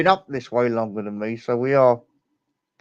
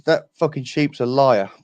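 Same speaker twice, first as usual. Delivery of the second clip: slow, deadpan punchline; slow deadpan Welsh farmer punchline; 3 semitones higher, 6 dB louder than usual. Acted. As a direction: land the punchline slowly.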